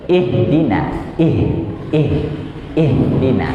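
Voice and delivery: slow "ihdina" with plenty of air let out on the H; airy, drawn-out H. Shown as a demonstration. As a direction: release the breath on the H of "ihdina". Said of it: "banyak yang dikeluarin".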